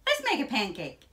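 loud, quick eager cry; excited shout. A narrator's reading. Jack urges his mother to start cooking, shouting. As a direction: call it out fast and high. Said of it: "shouted Jack".

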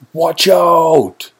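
high, loud alarm call; shouted warning. A narrator's reading. Sudden danger on the path, shouted warning.

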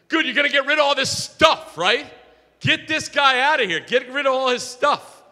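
loud, high-pitched indignant demands; mock-angry outburst, pushed loud and high; irate gentleman. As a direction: imitate a man ordering someone out. Acted irate neighbour confronting outreach worker.

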